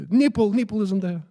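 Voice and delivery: high, blurted frightened answer; scared reply. Retold or imitated. Second priest answering the guru, scared and high. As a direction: imitate a squeezed priest, pitch jumping up. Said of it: "He's scared".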